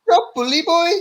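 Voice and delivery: very high, strained Scouse voice; exaggerated high-pitched Scouse; Darren Till. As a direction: drag it out in a high mock Liverpool accent. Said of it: "Darren Till impression".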